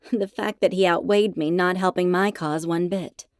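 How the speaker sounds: usual speaking voice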